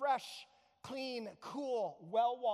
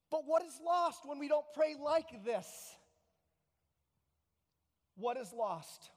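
ordinary delivery

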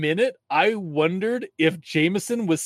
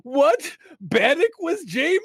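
high, stunned question; shocked; 12 semitones higher than usual. Retold or imitated. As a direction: blurt it in pure surprise. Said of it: "It was just like".

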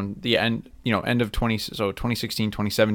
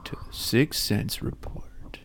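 whisper; 7 dB quieter, slower than usual. Pretend. Co-host whispers show name.

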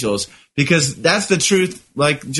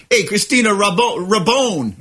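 hammed-up Italian accent on the name; mock Italian; 3 semitones higher than usual. Teasing co-host over the name's pronunciation, mock Italian accent. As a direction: imitate your co-host making a name lightly Italian. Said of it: "you made it very Italian".

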